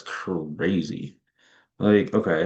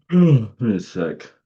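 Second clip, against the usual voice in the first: yawning; 3 semitones higher, 8 dB louder, slower than usual. Real, not acted. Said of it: "I'm, like, yawning a lot".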